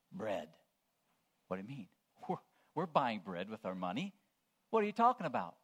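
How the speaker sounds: ordinary talk, nothing marked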